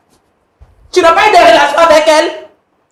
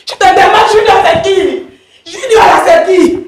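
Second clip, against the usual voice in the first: raised, pressing interrogation; angry demand; 3 semitones higher, slower than usual. Acted. Wife demanding who Junior is, heated and insistent. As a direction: push the question out, higher and exasperated.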